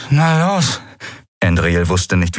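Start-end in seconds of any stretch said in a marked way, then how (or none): 0.1-0.8 higher, hurt but defiant; numb-lipped. A narrator's reading. Beaten Endriel goads her attacker, numb-lipped. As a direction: push the words past a numb lip. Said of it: "trotz der tauben Lippe".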